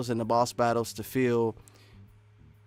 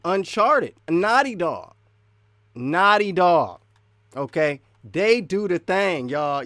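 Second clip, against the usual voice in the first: loud, raised, drawn-out praise; emphatic hype; 3 semitones higher, 9 dB louder, slower than usual. Real, not acted. Gamer hyping Naughty Dog to viewers, emphatic. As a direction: proclaim it slowly and loudly.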